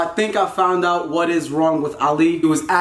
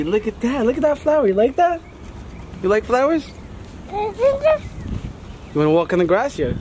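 ordinary talk, nothing marked

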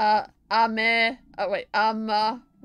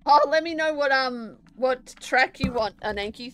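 normal talking voice

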